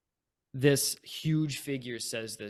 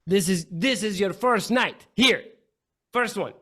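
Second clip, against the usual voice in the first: louder, higher threatening taunt; menacing brute; 6 semitones higher, 8 dB louder than usual. Acted. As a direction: loom over the newcomers.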